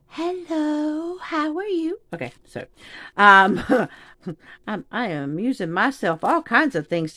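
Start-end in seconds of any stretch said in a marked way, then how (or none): 0.1-2.0 lightly voiced cute seahorse, higher and softer; voicing the seahorse. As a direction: give the little seahorse a sweet squeaky hello. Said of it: "It's like".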